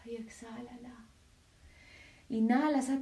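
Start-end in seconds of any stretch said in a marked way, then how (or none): 0.0-1.1 soft whispered cue. Shown as a demonstration. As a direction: breathe the words out unvoiced. Breathing exercise, whispered cue.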